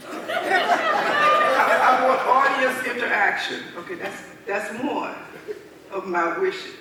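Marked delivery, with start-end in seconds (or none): 0.1-3.6 laughing. Real, not acted. Laughing as she states wish.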